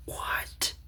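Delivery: breathless unvoiced whisper of disbelief; whispered what. Real, not acted. Stunned by the knight's rudeness, whispering. Hushed shock at the scene.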